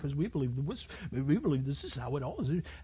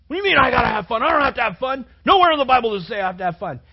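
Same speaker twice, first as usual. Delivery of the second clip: loud, high, fast mock outrage; loud, high imitated student rant; outraged student; 8 semitones higher, 15 dB louder, faster than usual. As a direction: sputter it like an indignant kid. Voicing furious students again.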